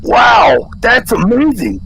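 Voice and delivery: loud, high infomercial amazement; amazed shout. Acted. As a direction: gasp it out, wowed by the product.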